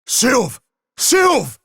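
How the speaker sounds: high, loud cry of a name; urgent calling out. Acted. Companion finding Sylve after a disaster, urgent calling out. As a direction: call out urgently for a lost friend.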